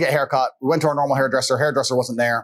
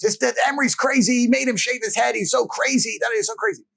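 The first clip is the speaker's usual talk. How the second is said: high mock voice of the mother; mocking mom; 10 semitones higher than usual. Mother telling everyone how crazy the dad was, high mock voice. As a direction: squeak it out like a scandalised mom. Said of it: "the story she recited".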